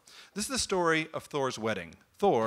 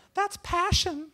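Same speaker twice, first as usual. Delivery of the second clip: falsetto bridesmaid; slower than usual. Acted. Loki as bridesmaid.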